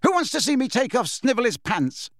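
high-pitched taunting announcement; calling out. A narrator's reading. James showing off to onlookers, taunting call. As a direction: call it to a crowd, pitched well up.

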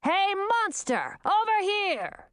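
high, slow calling shout; shouted taunt. A narrator's reading. Young wizard distracts the monster from Gracina, shouting. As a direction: call out to draw its attention. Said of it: "shouted Haywood".

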